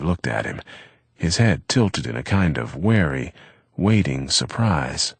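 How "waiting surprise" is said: ordinary delivery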